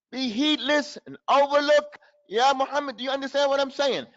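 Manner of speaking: normal talking voice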